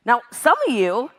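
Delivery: usual speaking voice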